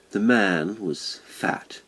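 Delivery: plain reading voice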